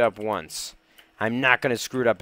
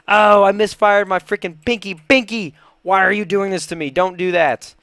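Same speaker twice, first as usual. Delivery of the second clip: high, loud pleading complaint; dismayed outburst; 6 semitones higher, 6 dB louder than usual. Real, not acted. Gamer scolds own pinky after a misclick, loud dismay. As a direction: cry out in exasperation at the misfire. Misclick makes the jump fail.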